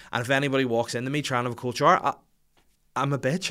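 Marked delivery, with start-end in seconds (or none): none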